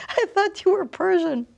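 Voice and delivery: retelling her startled reply on meeting him; surprised. Retold or imitated. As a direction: blurt it out in disbelief.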